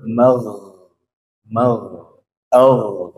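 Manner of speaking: "Mag-" ending in a smooth voiced gh; flowing gho. Shown as a demonstration. Teacher showing that the sound flows, smooth lingering gh. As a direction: let the sound run on after "mag-" without rasp. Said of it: "suaranya mengalir".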